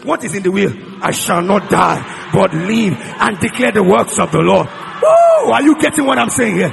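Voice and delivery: very loud, driving declamation; full-force preaching. Real, not acted. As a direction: thunder out the declarations to the congregation.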